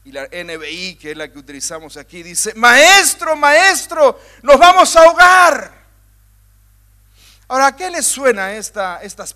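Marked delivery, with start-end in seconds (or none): 2.5-5.8 high, loud warning shout; urgent resigned warning. Acted. Preacher voicing Luke's disciples, a loud resigned warning. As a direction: cry the alarm with resignation. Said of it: "esta entonación".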